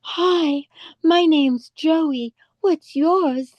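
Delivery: high, slow breathy-sweet impression. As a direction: coo it slowly like a starlet. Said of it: "she would go".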